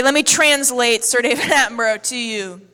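normal talking voice

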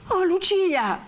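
high, wailing appeal; pained reproach. Acted. Renzo appeals to Lucia, high and wounded. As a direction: call the name in high-pitched hurt.

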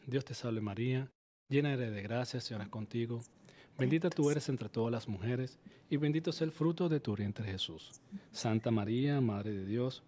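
soft, steady recited prayer to the Holy Spirit; gentle devotional recitation. Acted. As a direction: lead the audience in prayer, softly. Host leads Hail Mary prayer.

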